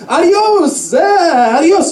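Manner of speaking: high, drawn-out adios; mock coworker goodbye. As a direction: wave them off like the office bore. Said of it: "he was like".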